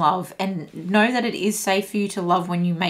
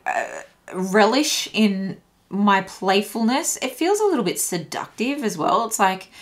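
normal talking voice

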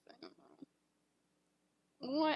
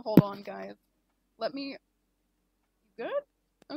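ordinary delivery

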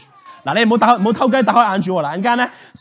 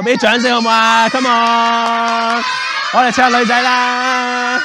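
slow, drawn-out, higher showman's call; rousing stage call; 4 semitones higher, 3 dB louder, slower than usual. Real, not acted. Magician calls a girl up to applause, rousing. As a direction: stretch each phrase out to rally the crowd.

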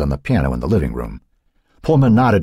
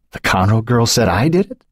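demanding; 6 semitones higher than usual. A narrator's reading. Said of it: "she demanded".

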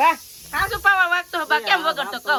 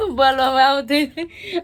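laughing; 4 dB louder than usual. Real, not acted. Still laughing after slip.

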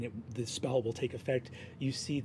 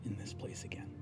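game master narrating an empty home softly, wistful; hushed story narration; 4 semitones lower, 10 dB quieter than usual. A narrator's reading. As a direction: let the empty house fall silent. GM's quiet closing narration.

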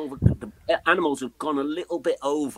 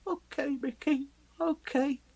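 resigned okay; 12 semitones higher, 3 dB quieter, slower than usual. Retold or imitated. Giving in to the manager.

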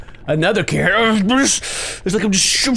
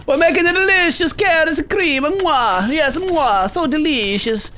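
high, singsong showy cook voice; hammed-up chef's delight; mock gourmet chef; 9 semitones higher than usual. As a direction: gush over the dish with kisses. Mock cook praising the dish.

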